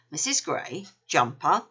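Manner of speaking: ordinary delivery, nothing marked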